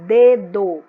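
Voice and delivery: "dedo" with a loud, punched first syllable; stress on first syllable. Shown as a demonstration. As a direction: hit the first syllable of "dedo" hard, let the second drop. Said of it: "a sílaba mais forte é D". ("dedo" com a primeira sílaba bem forte)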